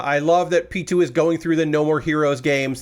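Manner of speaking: normal talking voice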